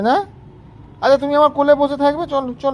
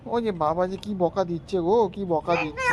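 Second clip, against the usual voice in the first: much softer, lower, pretending to cower; mock fright; 5 semitones lower, 7 dB quieter than usual. Playfully cowering from the child's scolding, hushed mock fear. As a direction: hush it like you're scared of a tiny boss. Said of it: "খুব ভয় পেয়ে গেছি আমি".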